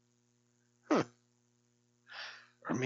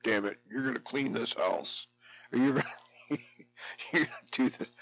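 raised, bossy commanding tone, half laughing; mock assertive order. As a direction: play the man of the house, ordering. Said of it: "when I assert myself and say".

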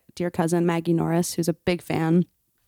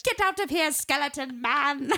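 imitating [name]; 6 semitones higher than usual. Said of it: "[name]'s like".

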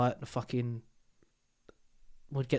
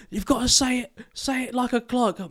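high-pitched, louder play-acted team shout; mock urgent calling; 10 semitones higher, 8 dB louder than usual. Paintball teammates arguing over clock directions, mock urgent shouting. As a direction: call it out in pretend urgency. Acting out flustered paintball exchange.

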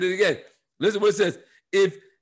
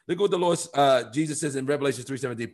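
ordinary talk, nothing marked

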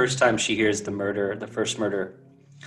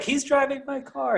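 mock quote; 12 semitones higher, 4 dB quieter than usual. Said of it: "I was like".

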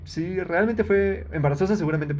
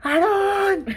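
mom's yell; 5 semitones higher, much slower than usual. Retold or imitated. Friend retelling mom yelling at her son from the balcony, high mock yell. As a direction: shriek it like a mom spotting the fight. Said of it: "su mamá seguramente grita más bonito".

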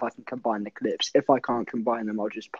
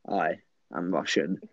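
low, softer Russian-accented mimicry; mimicked Russian accent; 7 semitones lower, 5 dB quieter than usual. Substitute teacher leaning into pupils' faces, low Russian accent. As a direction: lower your voice into a Russian accent. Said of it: "it's funnier in the accent".

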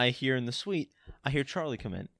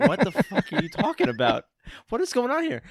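incredulous outburst; 7 semitones higher, 6 dB louder than usual. Retold or imitated. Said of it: "I'm like".

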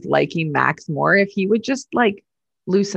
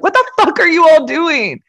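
higher, louder mock yell at coworkers; pretend yelling; 10 semitones higher, 5 dB louder than usual. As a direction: blow up at the whole office. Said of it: "a job where you could just yell at".

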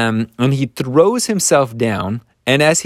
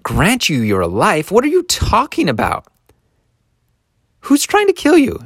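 high, disbelieving questions; astonished king demanding answers; incredulous; 10 semitones higher than usual. Acted. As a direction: climb into high-pitched, baffled disbelief. King's shocked reply, acted out.

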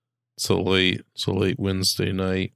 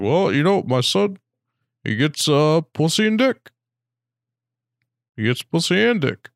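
Obama impression; 7 semitones higher than usual. Mock Obama bragging about his son. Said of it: "Obama if he had a son".

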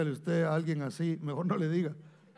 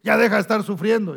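louder, brusque, faster brush-off; mock impatient dismissal; 3 semitones higher, 9 dB louder than usual. How Jesus did not speak to the widow, brusque and loud. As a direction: scold them to quit crying, hurried and impatient. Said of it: "no llegó y le dijo".

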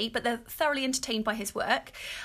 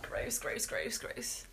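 whispered gross, softer, repeated; disgusted; 8 dB quieter, much slower than usual. Real, not acted. Recalling the cat eating sick, whispered disgust. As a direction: breathe out each gross in disgust. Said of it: "disgust at cat eating vomit".

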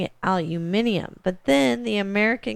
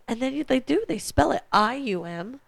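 ordinary delivery

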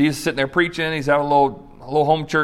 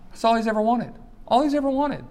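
much higher, emphatic repetition; paralytic's lifelong hope, said much higher; 7 semitones higher, 5 dB quieter than usual. Real, not acted. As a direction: press the longing home. Preacher stressing paralytic's longing.

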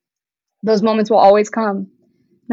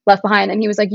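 ordinary delivery

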